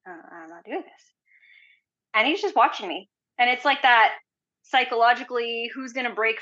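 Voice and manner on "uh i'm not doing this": quiet refusal. Retold or imitated. Sitting here like this.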